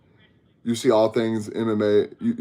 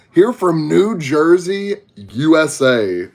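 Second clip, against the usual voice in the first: slow Texas drawl, stretched vowels; southern drawl; 5 semitones higher, 3 dB louder, slower than usual. Shown as a demonstration. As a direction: draw out each word like a Texan. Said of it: "As y'all can tell, I'm from Texas, Southern accent".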